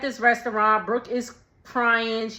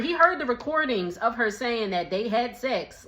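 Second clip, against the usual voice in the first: ordinary talk, nothing marked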